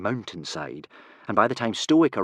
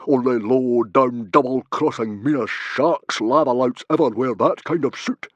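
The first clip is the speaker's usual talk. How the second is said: loud, raised, emphatic denunciation; exclaimed accusation; 4 semitones higher, 7 dB louder than usual. A narrator's reading. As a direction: denounce a hated enemy forcefully. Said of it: "exclaimed Stoic".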